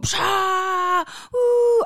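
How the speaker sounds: high, drawn-out mock crowd roar; imitated crowd cheer. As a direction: cheer like a whole audience. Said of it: "those crowd noises".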